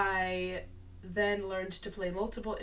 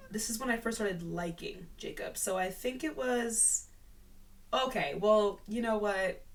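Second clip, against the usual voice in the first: normal talking voice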